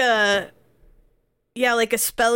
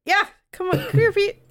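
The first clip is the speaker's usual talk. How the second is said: higher, coaxing dog call; 8 semitones higher, faster than usual. Real, not acted. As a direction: call the dog over, sweetly. Calling a dog over.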